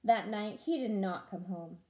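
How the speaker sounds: ordinary delivery, nothing marked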